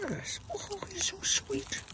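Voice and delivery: very high, slow soft coo. Real, not acted. Owner coos very high and soft at his sweet cat.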